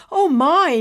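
loud, high cry of dismay; alarmed gasp. A narrator's reading. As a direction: gasp it out in shock. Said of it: "Nimbus gasped".

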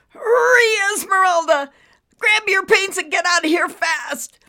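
high, loud, strained cry of warning; frantic warning at full pitch; urgent shout. Acted. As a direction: shout it as if about to burst. Cloud about to explode, warns her.

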